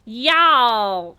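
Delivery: mock a non-Southerner overdoing "Y'all!"; forced Southern y'all. She mocks other people trying to say "Y'all!", a loud overdone fake twang. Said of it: "other people say y'all and they're like".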